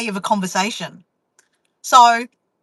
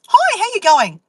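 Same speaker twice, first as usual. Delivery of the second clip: cheery cashier; 9 semitones higher, 5 dB louder than usual. Retold or imitated. Cashier greeting voiced brightly.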